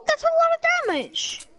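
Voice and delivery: infomercial pitchman. Retold or imitated. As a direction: belt it like a TV salesman. Flex Tape meme quote.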